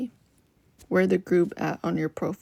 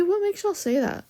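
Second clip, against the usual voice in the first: surprised question; 11 semitones higher than usual. Real, not acted. Reacts to viewer's koala claim.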